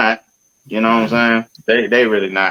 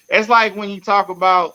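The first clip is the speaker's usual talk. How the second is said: normal talking voice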